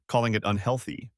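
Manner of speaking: ordinary delivery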